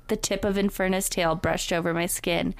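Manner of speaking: ordinary talk, nothing marked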